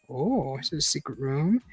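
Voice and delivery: ordinary talk, nothing marked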